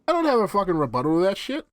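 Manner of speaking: ordinary talk, nothing marked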